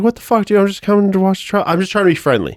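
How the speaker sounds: high, incredulous outburst; indignant disbelief. Retold or imitated. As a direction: sound baffled at being taken as hostile. Retelling his own indignant reply.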